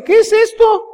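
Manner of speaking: high, loud outraged yell; outraged dad. Retold or imitated. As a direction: shriek it like a shocked dad. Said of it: "llega el papá y dice".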